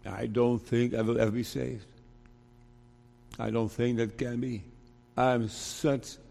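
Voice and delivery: a soft, hopeless confession; despairing listener. Retold or imitated. Preacher voicing a hopeless listener, softly despairing. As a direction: sink into quiet despair, voice dropping. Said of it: "Maybe you say".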